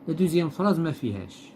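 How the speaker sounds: ordinary talk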